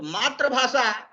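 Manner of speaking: मातृभाषा said as "maatra-bhasha", no "i" after the r; mispronounced मातृभाषा. Shown as a demonstration. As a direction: say मातृभाषा with "tra" in place of "tri". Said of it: "वह गलत है". (मातृभाषा में "तृ" की जगह "त्र" बोलें)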